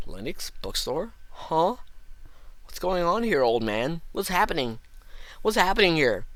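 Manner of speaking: startled, high, puzzled shopkeeper; surprised old man voice. Acted. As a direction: gasp out the questions in surprise. Acted clerk scene, high pitch.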